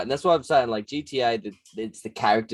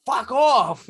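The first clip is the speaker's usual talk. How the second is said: high, loud brush-off; mock angry shout; 12 semitones higher, 5 dB louder than usual. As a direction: yell it at a pestering sidekick. Acted rebuff of pestering caller.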